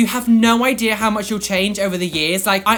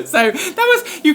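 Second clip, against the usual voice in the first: words squeezed out through a laugh; 11 semitones higher, 5 dB louder, slower than usual. Real, not acted. Still giggling while telling viewers the punchline, laughing. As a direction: still laughing, pitch up high.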